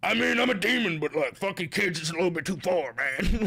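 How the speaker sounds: a lightly voiced demon, casual and reasonable; hammed-up demon shrugging it off; mock demon voice. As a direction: play a chill demon with a hint of menace. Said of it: "like a demonic voice".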